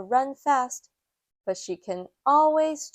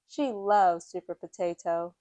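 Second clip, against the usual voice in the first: ordinary delivery, nothing marked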